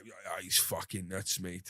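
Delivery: hungover dad. Retold or imitated. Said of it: "my dad's obviously hungover".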